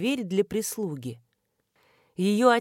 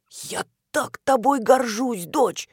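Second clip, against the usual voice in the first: louder, slow, bursting with pride; proud and excited; 6 semitones higher, 5 dB louder, slower than usual. Acted. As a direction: beam with fatherly pride, loud. Proud father hugging daughter.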